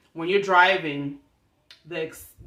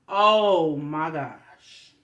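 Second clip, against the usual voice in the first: very slow, stretched exclamation; long exasperated groan of words; drawn-out dismay; 4 dB louder, much slower than usual. Retold or imitated. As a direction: drag out every word in dismay. Retold dismay at breakdown.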